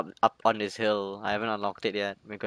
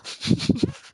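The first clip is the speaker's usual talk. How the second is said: high nervous laugh; 3 dB louder than usual. Real, not acted. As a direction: giggle in a high voice. Laughs after startle.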